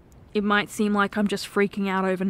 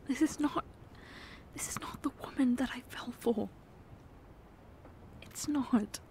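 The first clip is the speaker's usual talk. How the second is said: soft, hurt whisper; almost too sad to voice it; pained whisper; 12 dB quieter than usual. Acted. Whispering his hurt at how his girlfriend has changed. Comforting partner after drinking confession.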